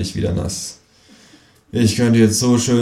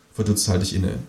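ordinary talk

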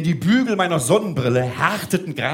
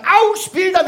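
alarmed call; 13 semitones higher, 5 dB louder, slower than usual. Retold or imitated. Woman calls out in panic.

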